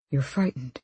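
low, soft remark; quiet observation. A narrator's reading. Man noticing her fear, quiet observation.

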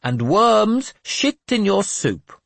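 very high, slow, lofty curse. Acted. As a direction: pronounce it like a benediction. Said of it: "Jeremias said loftily".

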